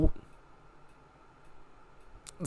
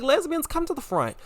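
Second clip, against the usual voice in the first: playful summons; 9 semitones higher, 3 dB quieter than usual. Retold or imitated. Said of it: "I was like".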